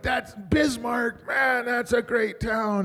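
slow, high gushing praise; enthusiastic fan. Retold or imitated. Retelling excited fan's praise.

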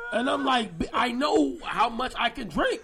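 slow, high, loud laughing impression of a friend; friend's voice. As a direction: drag it out like a stubborn friend while laughing. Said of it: "you know how he talk".

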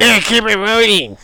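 high, loud, slow goofy voice; Rob Schneider impression. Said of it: "That's Rob Schneider".

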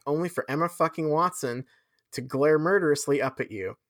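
normal talking voice

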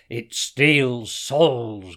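slow, loud lion growl; loud, drawn-out beast voice. A narrator's reading. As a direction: growl it out, stretching each word. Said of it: "ground Hassan".

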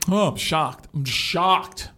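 mock gasp of fake shock; sarcastic shock. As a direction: act shocked, dripping sarcasm. Mocking fake surprise.

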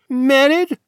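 put-on young duke, startled and loud; sharp question. A narrator's reading. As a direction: snap it out in alarm. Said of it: "asked the Duke".

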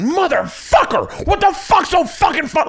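very high, drawn-out furious outburst; losing his shit. Retold or imitated. Dad at laughing kids after the football hit, furious outburst. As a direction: re-enact totally blowing up in a high-pitched rage. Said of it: "Like, lost my shit".

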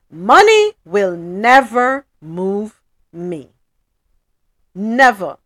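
slow, firm, every word weighted; slow emphatic declaration. Real, not acted. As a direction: spell it out slowly and firmly. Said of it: "emphatic declaration".

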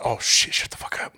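low, unvoiced mutter of shock; hushed aside. Bystander watching the football game sees trouble start, hushed aside. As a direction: whisper it under your breath.

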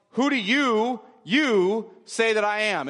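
"you" hit high and then said a second time, drawn out; emphatic repeated YOU. Shown as a demonstration. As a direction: punch "you", pause, repeat "you" with weight. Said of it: "It's actually repetitive. It reads like".

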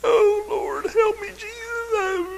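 high, wailing cry for the Lord; mock wailing. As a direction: ham up a crying plea, high and slow. Mock wail at bad news.

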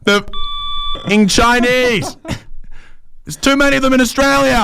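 tearful outburst. Retold or imitated. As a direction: imitate a man breaking down mid-complaint. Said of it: "erupted into tears, and said".

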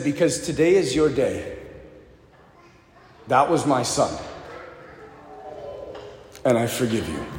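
low, soft, solemn retold reply; tender forgiveness. Retold father forgiving his son's killer, low and tender. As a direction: hush it gravely, with long pauses between sentences.

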